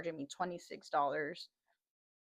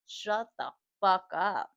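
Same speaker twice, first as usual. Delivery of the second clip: loud, slow retold put-down; exasperated dismissal, retold; 6 dB louder than usual. Rider silencing the passenger, slow exasperated retelling. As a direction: draw it out loudly, word by word.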